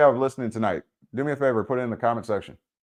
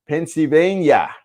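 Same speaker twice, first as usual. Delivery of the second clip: higher, drawn-out pretend Pennsylvania accent; mock Pennsylvania accent; 6 semitones higher, 5 dB louder, slower than usual. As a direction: stretch it out in a hammed-up Pennsylvania accent. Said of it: "Is that the accent?".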